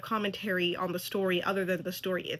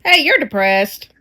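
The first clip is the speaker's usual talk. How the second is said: much louder mock echo of a line; loud mimicking; 14 dB louder than usual. Viewer parroting the show's line, loud mockery. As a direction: throw the line back loudly.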